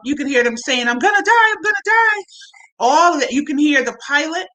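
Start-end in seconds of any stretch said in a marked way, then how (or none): 0.9-2.2 high, panicked cry of a crash victim; mock terrified scream, pitched way up; retelling the plane recording's screams, high and panicked. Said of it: "you can hear them screaming".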